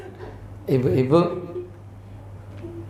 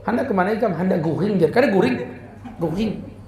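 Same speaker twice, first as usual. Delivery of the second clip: put-on Banjar accent. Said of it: "Orang Banjar nih kalau ngomong R".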